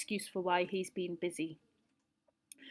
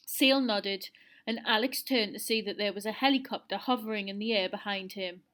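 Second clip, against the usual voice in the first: ordinary delivery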